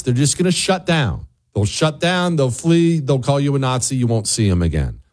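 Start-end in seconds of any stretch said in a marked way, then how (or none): none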